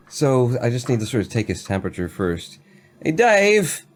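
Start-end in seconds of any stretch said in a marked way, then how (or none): 3.0-3.8 a rough local lad calling out to the jailer; Daryl in character. Acted. Daryl calling out to jailer Dave from his cell. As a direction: hail the guard with a drunken grin. Player voices Daryl in accent.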